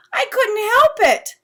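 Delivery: high, loud, slow whine; whining. Acted. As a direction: drag it out like a sulky boy. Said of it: "kind of whining".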